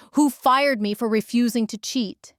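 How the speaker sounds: usual speaking voice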